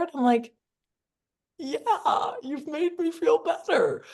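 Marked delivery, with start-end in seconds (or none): 1.6-4.0 laughing. Retold or imitated. Retelling cheerful reply, laughing.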